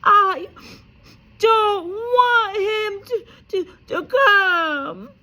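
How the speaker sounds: high, loud, slow fake wailing; loud high slow pretend sobs; fake sobbing. A narrator's reading. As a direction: wail it like a spoiled child. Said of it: "Dudley yelled between huge pretend sobs".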